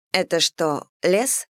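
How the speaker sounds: usual speaking voice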